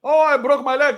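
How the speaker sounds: mock a whining kid; mock whining child.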